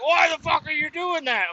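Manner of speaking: hammed-up angry coworker shout; angry yell. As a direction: play the drenched coworker yelling back. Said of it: "He's like".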